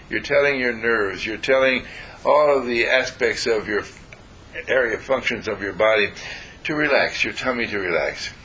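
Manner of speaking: normal talking voice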